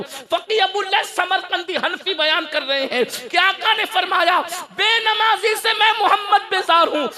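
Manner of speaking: high, forceful voice building to a shout; impassioned preaching. Real, not acted. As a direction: drive it up to a thundering climax.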